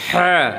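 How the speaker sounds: 'হ্যাঁ' squeezed from the throat; pressed throat H. Shown as a demonstration. Teacher shows the harder H, 'হ্যাঁ' pressed. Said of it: "pressure দিয়ে বলতে হবে".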